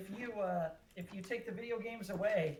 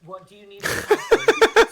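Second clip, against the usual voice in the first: loud eager imitation; 20 dB louder than usual. Imitating wife's daily question.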